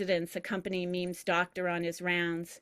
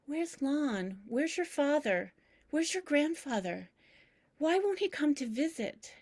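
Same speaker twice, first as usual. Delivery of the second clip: put-on higher, anxious old woman asking; confused pleading; 8 semitones higher than usual. Grandmother asking for her dead husband, higher and anxious.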